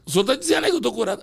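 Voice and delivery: high, fast cry of amazement, imitated; excited disbelief. Healed woman asking the doctor, high, fast and amazed. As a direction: gasp it out amazed.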